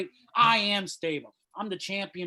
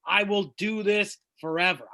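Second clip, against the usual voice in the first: usual speaking voice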